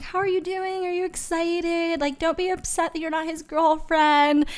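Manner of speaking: high, soft mock-concerned roommate voice; a softly voiced roommate checking on her; imitating worried roommate. Roommate comforting her, quoted.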